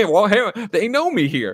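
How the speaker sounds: high, loud cocky brag; smug Brody. Retold or imitated. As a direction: show off that they know you here. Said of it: "And he goes".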